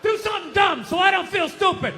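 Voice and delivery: loud, high shouted plea; shouting. Acted. Comic begging the crowd, shouted high. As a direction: bellow it at the crowd.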